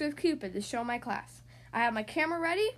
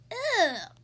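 loud high ugh of disgust; 8 semitones higher, 6 dB louder than usual. A narrator's reading. Girl groaning ugh while spitting out jelly beans. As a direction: spit out the word in disgust. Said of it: "spitting them out".

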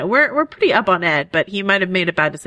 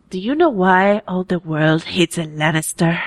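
Oberyn Martell. Acted. Performing a quote from the episode, prince impression. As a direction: put on the prince's accent, deliberately paced. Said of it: "I can do a Martell impression".

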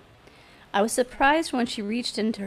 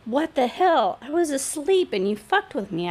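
higher, louder indignant complaint; aggrieved complaint; 5 semitones higher, 3 dB louder than usual. A narrator's reading. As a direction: complain as the one who was wronged. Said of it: "I complained".

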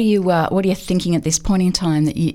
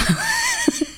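laugh; 4 dB quieter than usual. Real, not acted. Host laughs at her own slip.

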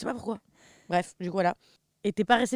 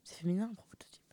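whisper; 10 dB quieter than usual. Real, not acted. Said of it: "Je sais pas de quoi tu parles".

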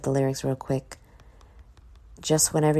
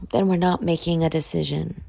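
ordinary talk, nothing marked

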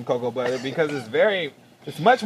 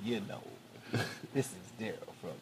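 late night DJ voice; 7 semitones lower, 14 dB quieter than usual. Pretend. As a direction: purr it like a night DJ. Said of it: "I used my telephone like a talk".

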